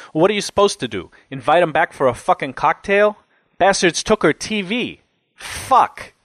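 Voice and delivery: indignant cellmate blowing off steam; ranting cellmate, indignant. A narrator's reading. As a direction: play a riled-up jailbird venting outrage.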